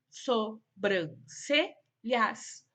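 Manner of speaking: "sobrancelhas" slowly split so-bran-ce-lhas; syllable by syllable. Shown as a demonstration. Teacher showing pupils syllable division, "sobrancelhas" in separated syllables. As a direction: pause after each syllable of "sobrancelhas". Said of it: "foram separadas em sílabas".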